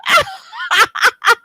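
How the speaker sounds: high, loud burst of laughter. Real, not acted. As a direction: crack up at a bad accent. Host laughs at the fake accent.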